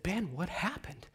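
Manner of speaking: soft, slow whispered question. Retold or imitated. People asking the conductor, in a whisper. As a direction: lean in and whisper it.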